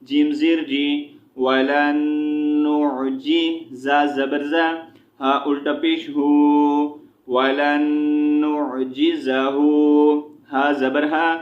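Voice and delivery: measured chant, syllables held long; Quran spelling drill. Shown as a demonstration. Teacher spelling Quranic words for learners, chanted drill. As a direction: spell out each letter, then draw out the joined syllable.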